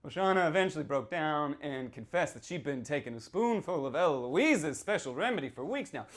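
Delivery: usual speaking voice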